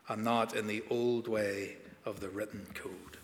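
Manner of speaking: measured, solemn Bible reading; scripture reading. Acted. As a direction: read the passage steadily, like a lector. Reads scripture before sermon.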